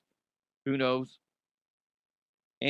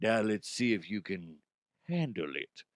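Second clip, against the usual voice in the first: softer, menacing taunt; low taunt; 6 semitones lower, 6 dB quieter than usual. Retold or imitated. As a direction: drop the voice to a threat. Fighter taunting.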